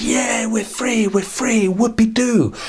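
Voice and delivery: high, joyful "we're free" cheer; giddy little celebration. Acted. Rescued girl and grandma cheering their freedom: high joyful cheer. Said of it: "and they said".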